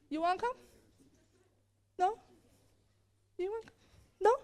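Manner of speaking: hushed, questioning little prods; soft taunting. Acted. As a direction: quietly dare each one in turn. Same taunt on the next day.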